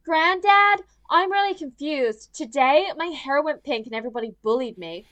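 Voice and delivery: mocking Malia whining to her granddad; whiny granddaughter. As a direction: play the spoiled teen complaining. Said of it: "she's like".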